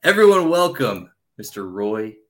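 ordinary talk

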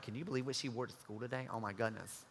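hushed, catty gossip behind her back; low, lighter voice trading a juicy rumor. Retold or imitated.

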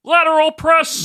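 high excited announcer call; announcer impression. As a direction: shout it like a wrestling commentator. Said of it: "Morrow would say".

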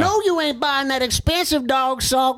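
high, calling older lady's impression; thrift store lady. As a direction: call a favorite customer over in an older lady's high voice. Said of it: "I'll do her voice at the thrift store".

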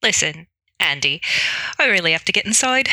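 put-on Irish accent slipping into Australian. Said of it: "got a little bit Australian".

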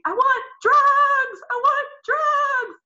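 very high, slow, loud desperate scream; screaming for drugs. Retold or imitated. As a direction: wail it out in pain. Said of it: "I kept screaming".

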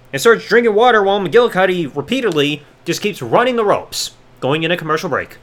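ordinary talk, nothing marked

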